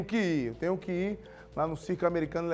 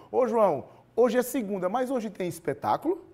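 calling out; 6 semitones higher, 5 dB louder than usual. Real, not acted. Anchor calls out to field reporter.

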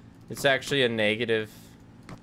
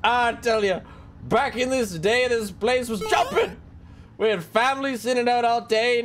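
put-on higher, drawling old diner manager; folksy manager voice; 9 semitones higher than usual. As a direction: tell it like a chatty old-timer. Reads game manager dialogue.

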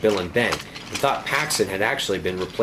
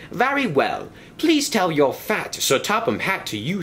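pompous official, voiced higher; a fussy railway inspector laying down the law; high-pitched stern inspector; 6 semitones higher than usual.

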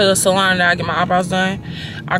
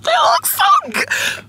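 much higher, slow, squealing with delight. Real, not acted. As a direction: squeal it, thrilled with your brows. Thrilled with her new eyebrows.

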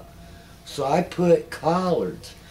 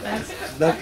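laughing. Real, not acted. Laughing as he pictures Doc. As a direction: laugh through it.

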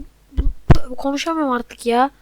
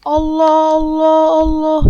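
drawn-out exasperated cry; 3 semitones higher, 11 dB louder, slower than usual. Real, not acted. Frustrated gamer watching a save.

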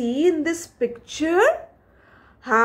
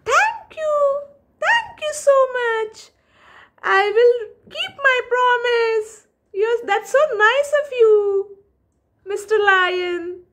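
much higher, quick, teary mouse thanks; grateful squeaky mouse; 10 semitones higher, faster than usual. Acted. Mouse thanking the lion for sparing him, high and quick. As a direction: gush thanks like a tiny relieved mouse.

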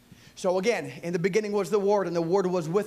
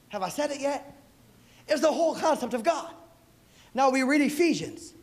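normal talking voice

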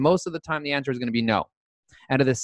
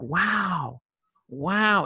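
retold amazement; 3 semitones higher than usual. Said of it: "now I'm like".

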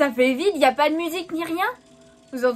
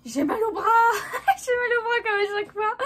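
high, fast, laughing through words; 9 semitones higher, faster than usual. Real, not acted. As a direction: giggle out the complaint. Laughing about sore arm.

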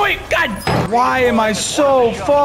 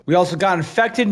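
usual speaking voice

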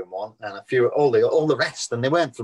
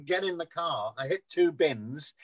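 usual speaking voice

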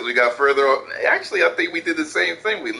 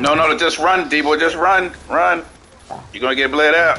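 loud, pressing yell to run; urgent shouting; 7 dB louder than usual. Real, not acted. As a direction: push a teammate to flee fast. Urging teammate to flee.

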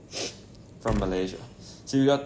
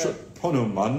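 put-on British accent; 2 semitones higher than usual. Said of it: "Say it in a British accent".

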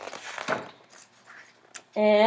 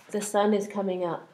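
plain reading voice

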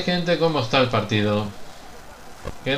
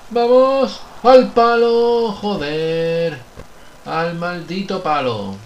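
higher and louder, then deflating; frustrated shout; 3 semitones higher, 4 dB louder, much slower than usual. Real, not acted. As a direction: yell as the ball hits the post. Shot hits the post.

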